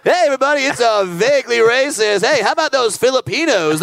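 hammed-up talk show opener; mock show host. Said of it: "back to Vaguely Racist with Brad Williams".

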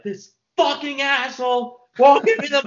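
high, loud furious yell; retold scream. Screaming to dad on the phone in fury. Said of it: "screamed to my dad".

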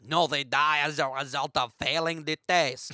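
put-on amateur French mime accent. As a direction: correct them in a French accent. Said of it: "my French accent isn't that good".

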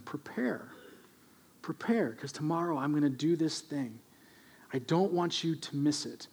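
soft, reassuring divine whisper; tender God. Acted. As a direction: coax them quietly to trust. God speaks tenderly to Israelites.

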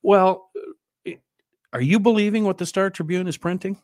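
high, loud and fast; loud challenge. Real, not acted. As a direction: fire the question at him. Challenging question.

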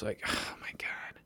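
exasperated whisper. Real, not acted. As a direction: breathe it under your breath. Aside after wife quote.

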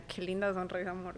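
soft, low flirty compliment; coqueteo suave. Retold or imitated. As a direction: say it quietly, like sweet-talking a date. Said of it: "yo le decía". (piropo bajito y coqueto)

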